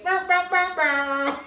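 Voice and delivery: loud high mouth-made air horn; DJ horn imitation. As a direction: blare it like a DJ's horn. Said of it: "here go the D J".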